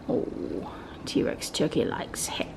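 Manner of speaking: hushed whisper; soft secret whisper. Real, not acted. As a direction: whisper it close. Speaking hushed near the toy.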